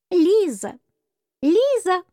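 high squeaky calling out; squeaky mouse voice. Acted. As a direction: squeak the name like a tiny mouse. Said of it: "послышался вдруг пискливый голосок серой мыши".

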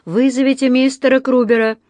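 high, loud carrying summons; sustained echo of a shout; echoing call. A narrator's reading. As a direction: pass the call along a corridor. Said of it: "эхом разнеслось по коридору".

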